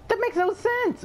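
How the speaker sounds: very high-pitched disbelief; incredulous. Real, not acted. Player baffled by Earth on the map, incredulous. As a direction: squeal it out in disbelief.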